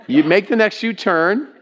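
lightly voiced navigation prompt; hint of an automated guide; GPS voice. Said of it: "You know what she says?".

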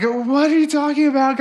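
high, alarmed disbelief; squeaky shocked denial; freaked out. Retold or imitated. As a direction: play dumb in a panic. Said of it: "freaky, like".

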